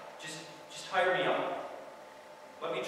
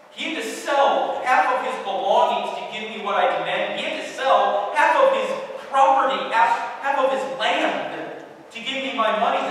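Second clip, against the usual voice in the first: louder and higher, working himself up over what he demanded; rising anguished outburst; 6 semitones higher, 7 dB louder than usual. Acted. Son dreading the father he wronged, building anguish. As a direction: let the guilt swell until it spills out loud.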